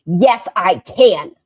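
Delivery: loud, slow imitation of a stubborn patient; mock defiance. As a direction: bellow it back, drawn out and mocking.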